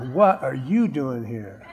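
half-laughing, quietly stunned; quiet mock astonishment with a chuckle; amused shock. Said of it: "They're gonna go".

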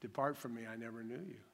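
a quiet dismissive judge; lightly voiced stern Lord. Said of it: "the Lord to say".

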